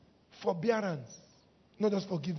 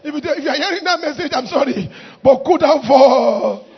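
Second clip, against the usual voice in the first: loud and high, stretching "cool down for"; mock defiant shout; 4 semitones higher, 14 dB louder than usual. Church member passing the pastor's message to another, defiantly shouted.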